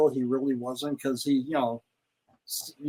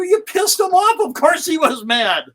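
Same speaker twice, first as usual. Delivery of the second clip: much higher, louder scolding; exasperated retort; 12 semitones higher, 7 dB louder than usual. Retold or imitated. Telling the Sheik why the soldier was mad, loud and incredulous. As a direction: throw it back at him, incredulous. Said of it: "I go".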